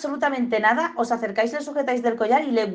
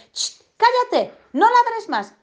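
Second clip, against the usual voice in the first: much higher, sing-song scolding of the dog; overly sweet voice; 12 semitones higher, 4 dB louder, slower than usual. Retold or imitated. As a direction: coo the telling-off like baby talk. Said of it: "le decimos con una voz súper amable".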